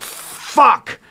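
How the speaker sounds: high, drawn-out exclamation of dismay; alarmed curse. Retold or imitated. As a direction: curse in alarm, pitch jumping up then falling. Alarmed at seeing trapped partner.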